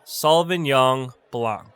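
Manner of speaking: Sauvignon Blanc said slowly, SAW-vin-yawn blahnk; slow pronunciation. Shown as a demonstration. Teacher repeats a wine name, slow careful pronunciation. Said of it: "That's how you pronounce".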